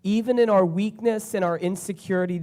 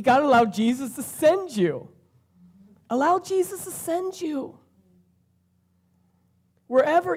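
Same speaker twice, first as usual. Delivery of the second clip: getting emotional; 8 semitones higher than usual. Real, not acted. Preacher urging the congregation, choked with emotion. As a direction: voice welling up with emotion, pitch rising high. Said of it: "I don't know why I'm getting emotional".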